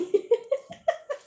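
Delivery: sudden high-pitched giggle; high burst of laughter. Real, not acted. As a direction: let a squeaky laugh burst out. Laughs at the nickname.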